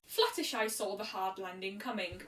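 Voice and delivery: usual speaking voice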